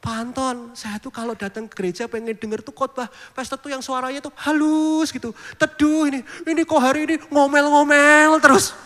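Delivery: fussy grandma scolding the pastor; complaining grandma. Retold or imitated. Elderly churchgoer complaining to the pastor, mild fussy old-lady voice. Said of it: "oma-oma datang".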